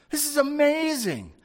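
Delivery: high-pitched, excited wonder; amazed exclamation. Real, not acted. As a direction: exclaim it, still thrilled by the miracle. Marvelling at the miracle just acted.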